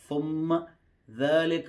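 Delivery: usual speaking voice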